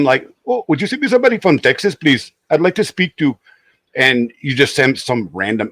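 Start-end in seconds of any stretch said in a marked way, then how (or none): 0.4-3.4 quick, high imitation Indian accent; mock Indian accent, pitched up. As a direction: ask politely in a put-on Indian accent. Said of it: "in their own accent".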